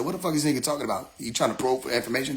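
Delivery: quick doubting aside; suspicious muttering. Retold or imitated. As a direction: rattle it off under your breath, suspicious. Others suspicious of his meaning.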